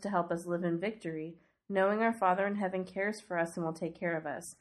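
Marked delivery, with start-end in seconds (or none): none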